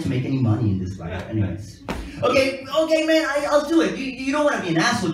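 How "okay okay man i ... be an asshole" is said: high-pitched, hurried concession; worked-up, reluctant agreement; flustered giving in. Acted. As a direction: give in, voice up and louder. Acted reluctant giving in to a friend.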